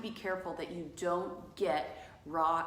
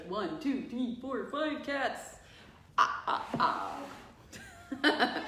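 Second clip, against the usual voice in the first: playful puppet-show count, pitch climbing, capped with a mock laugh; hammed-up counting, slowing on the last words, then a staccato cackle; Sesame Street counting; 4 semitones higher, much slower than usual. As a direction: count the cats up like a children's TV character. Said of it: "Sesame Street".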